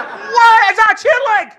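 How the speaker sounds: very high, loud shocked yelp. Retold or imitated. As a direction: recoil at the sight of the tube. Own shocked reaction to tube.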